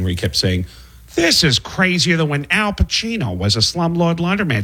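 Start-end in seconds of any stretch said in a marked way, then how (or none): 1.1-4.6 lightly voiced cartoon dad; a hint of Peter Griffin; mild Peter Griffin impression. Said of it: "Seth MacFarlane kept doing one line as Peter Griffin".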